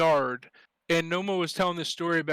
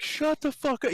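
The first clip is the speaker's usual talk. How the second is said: fuming; 9 semitones higher than usual. Retold or imitated. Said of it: "Nova was fuming".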